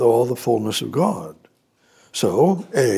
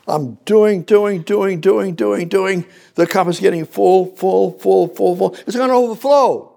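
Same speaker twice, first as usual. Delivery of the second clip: very high, loud fervent preaching; preaching at full force; 8 semitones higher, 5 dB louder than usual. Real, not acted.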